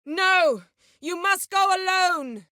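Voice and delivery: desperate shout; urgent shout. Acted. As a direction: push him away to safety.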